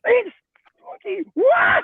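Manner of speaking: high, loud whooping shout; joyful cheer. Real, not acted. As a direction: whoop with delight at the comment. Cheering a friend's comment.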